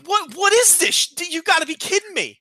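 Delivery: high-pitched, stammering disbelief; incredulous. Retold or imitated. Friend told dogs brought back the horn, incredulous.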